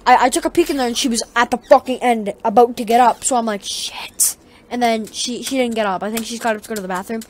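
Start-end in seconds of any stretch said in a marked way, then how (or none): 3.6-4.4 hushed, breathy shit under the breath; whispered curse. Retold or imitated. Kid hiding in the garage, whispering a curse. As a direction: swear under your breath so she won't hear.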